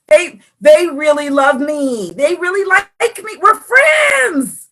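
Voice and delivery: high-pitched mock pleading; rising into a hammed-up little wail; naive insisting. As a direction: insist in a squeaky, self-deluded voice. Own naive excuses acted out.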